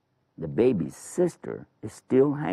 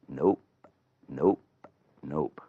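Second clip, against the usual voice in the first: low, short nopes with pauses; lower, offhand baby's refusal; deadpan refusal; 5 semitones lower, slower than usual. Acted. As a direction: refuse each time, unbothered and low. Baby refusing to nurse.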